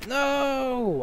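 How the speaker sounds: high, drawn-out falling cry; long high moan of dismay; dismayed wail. Real, not acted. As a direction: wail it out in protest. Dismay at the narrator's choice.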